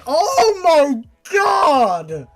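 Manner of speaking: sudden loud cry of surprise; startled shout. Real, not acted. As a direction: blurt it out in shock. Reader's startled outburst.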